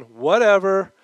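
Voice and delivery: mock disciples shrugging off Paul; dismissive. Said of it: "fatalistic resignation".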